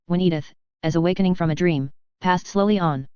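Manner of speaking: usual speaking voice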